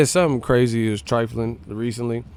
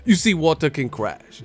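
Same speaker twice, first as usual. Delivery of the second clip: Bruce Lee impression; 2 semitones lower, 5 dB quieter than usual. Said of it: "Bruce Lee would be like".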